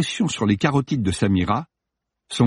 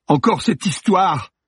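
higher, slow, whiny lament; whining groan; 9 semitones higher, 3 dB louder than usual.

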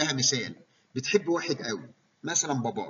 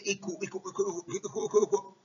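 "ايكو" repeated again and again, higher and fading; echoing repetition; 6 semitones higher than usual. Shown as a demonstration. Lecturer demonstrating echolalia to students, repeating "ايكو" higher. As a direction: echo "ايكو" like a bouncing echo. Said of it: "ايكولاليا اللي هي repetition".

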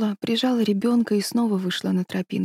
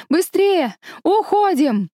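urgent high shout; 11 semitones higher, 4 dB louder, slower than usual. Acted. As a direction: yell at them to run. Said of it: "закричал Цзянь Линь". (громкий срочный крик)

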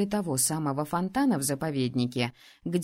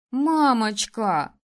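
very slow, louder Мамочка with a falling tune; children in unison; 3 semitones higher, 6 dB louder, much slower than usual. A narrator's reading. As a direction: call Мамочка together like pleading kids. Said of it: "в один голос произнесли дети".

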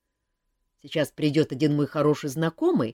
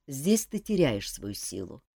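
plain reading voice

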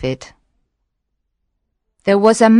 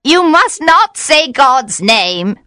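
high, loud, slow witch voice; warning witch; 7 semitones higher, 4 dB louder, slower than usual. A narrator's reading. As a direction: scold him like a cornered witch. Witch scolds the fisherman.

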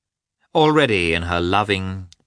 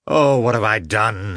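remorseful exclamation; 2 semitones higher, 4 dB louder than usual. A narrator's reading. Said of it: "he exclaimed".

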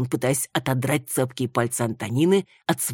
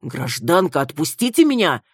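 alarmed; 3 semitones higher, 6 dB louder than usual. A narrator's reading. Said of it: "шарахнулся Руслан в сторону".

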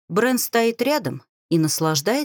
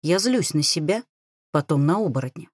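ordinary delivery, nothing marked

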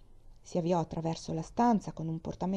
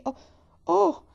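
breaking into tears; 11 semitones higher, 9 dB louder than usual. Acted. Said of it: "E ruppe in pianto".

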